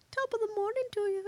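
high, quick cartoon Irish lilt; mock Irish accent. Co-host tries a go-to starting phrase, mock Irish accent. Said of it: "Every time I try to do an Irish accent".

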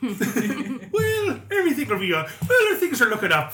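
high, loud hammed-up Irish brogue; mock Irish optimism. Comedian mocking a cheerful Irishman in a high brogue. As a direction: chirp it out like a carefree Irishman. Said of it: "Irish accent".

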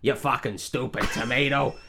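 put-on grouchy American trainer voice; Phil from Hercules. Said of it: "doing it like fucking Phil from Hercules".

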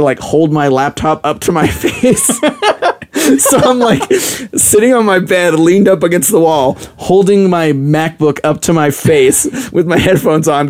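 laughing at herself while telling it. Real, not acted.

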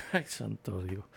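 laughing. Real, not acted. Laughing at the Jehovah's Witness joke.

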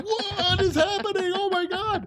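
high, slow panicked cry. Retold or imitated. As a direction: shriek it in mock alarm. Retold panic at dog attack.

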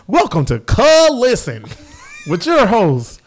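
slow, loud mock show intro; disguised host voice. Podcaster introducing the renamed show under fake names, put-on disguised voice. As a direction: stretch out each made-up name grandly. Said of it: "Put the voice modulator on it".

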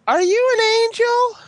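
squeaky high voice; high falsetto. Pretend. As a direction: pitch way up into falsetto. Sudden high put-on voice.

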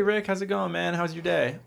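mocking a buddy's hollow hello; mock cheery greeting. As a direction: chirp it like forced small talk. Imitating cheery small talk.

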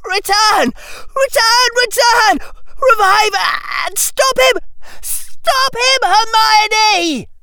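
very high, loud, desperate screams; pleading shrieks at the top of the voice; rising shriek. A narrator's reading. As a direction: scream each command in frantic bursts. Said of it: "Harry's voice rose further".

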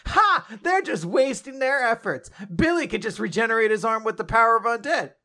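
high, excited mock anime commentary; confident sideline boast. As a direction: crow it like an anime sidekick on the sidelines. Acted sideline cheerleader in battle.

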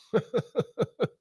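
short high amused laugh; a quick laugh at his own joke; real laugh, high and brief. Real, not acted.

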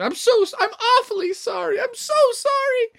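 high, loud, squealed apology; frantic apology. Acted. Froggy tries catching the books, frantic high apology. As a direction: panicky frog falling over his words.

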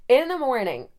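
ordinary talk, nothing marked

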